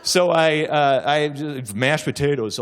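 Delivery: ordinary talk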